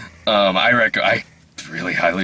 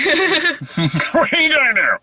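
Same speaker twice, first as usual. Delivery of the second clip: slow, much higher put-on voice; stretched-out, laughing celebrity impression; Ben Affleck impression; slower than usual. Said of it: "someone just does the Ben Affleck".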